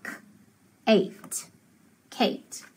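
"k", pause, "eight", then blended "Kate"; sounded out. Shown as a demonstration. Teacher blending C with long A, sounded out. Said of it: "before I sound it out".

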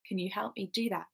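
ordinary delivery

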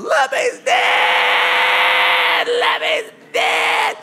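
piercing high shriek, very drawn out; long wailing mock scream. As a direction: imitate a hysterical wife screaming at full volume. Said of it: "and Nicole's still screaming".

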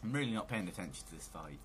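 ordinary talk, nothing marked

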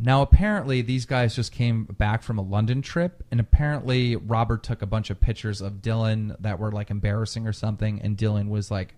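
ordinary talk

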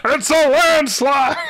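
over-the-top victory call; hype shout. Pretend. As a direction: bellow the result. Shouted announcement.

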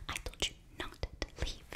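normal talking voice

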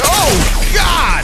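loud, very high shriek of alarm; alarmed scream. Real, not acted. Player caught out in the game, alarmed scream. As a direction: scream it in sudden panic.

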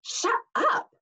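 high, tight angry hiss. Acted. Nancy to Grover, red-faced, hissing angrily. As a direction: spit it at him in embarrassment. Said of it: "Nancy hissed".

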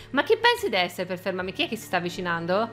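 ordinary talk, nothing marked

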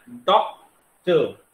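Doctor split into two slow beats, pausing after डॉक; doctor drawn out with a clear gap before the last syllable; syllable break. Shown as a demonstration. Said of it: "जहां भी थोड़ा सा ठहराव आता है".

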